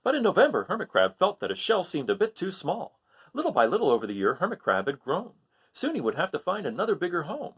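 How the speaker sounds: lively picture-book reading, rising on each list item; story narration. A narrator's reading. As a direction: read it to children, animated and sing-song. Reads picture book aloud.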